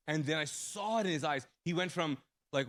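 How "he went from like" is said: ordinary delivery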